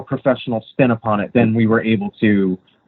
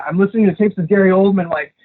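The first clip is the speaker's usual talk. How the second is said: lightly voiced fretting actor, higher; retold anxious co-star; 8 semitones higher than usual. Retelling what the actor said before the table read, mildly anxious. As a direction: mimic his worry about the accent. Retelling the co-star's accent worries.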